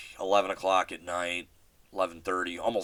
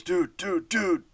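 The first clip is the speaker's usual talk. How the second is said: much higher, frantic calling; panicked dude; 11 semitones higher, slower than usual. Retold or imitated. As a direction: squeak out an alarmed dude, dude, dude. Friend spots a second skunk.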